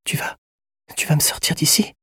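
high, whispery, halting question; fragile hope. A narrator's reading. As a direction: ask hopefully in a thin voice. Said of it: "un espoir se dessinant dans son regard".

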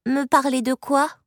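put-on a touch of unease in a little girl's voice; sudden worry. A narrator's reading. Child to the woman who wants to talk, mildly anxious. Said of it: "soudain inquiète".

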